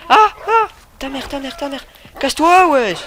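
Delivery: high, loud furious cursing; angry yelling. Real, not acted. Gamer yelling insults at an enemy, furious. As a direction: chase him off with yells.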